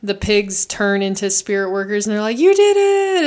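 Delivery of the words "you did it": high, loud joyful cheer; excited cheer. Retold or imitated. Spirit workers cheering Chihiro's win, high excited cheer. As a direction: cheer her on at full pitch.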